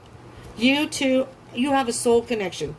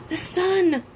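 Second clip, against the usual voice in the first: sharp gasp, then a high cry of The Sun; gasp, then announce it, astonished; gasping surprise; 9 semitones higher than usual. Real, not acted. Reader revealing the bottom card to Aquarius, amazed.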